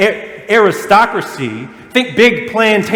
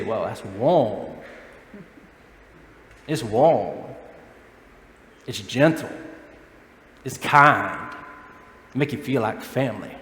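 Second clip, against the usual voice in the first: soft, lower and hushed, lingering on each word; gentle; 8 semitones lower, 8 dB quieter, slower than usual. Retold or imitated. Preacher voices how Southerners fondly describe their hospitality, softly. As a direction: hush it like a fond compliment.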